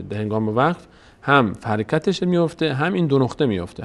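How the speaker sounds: ordinary delivery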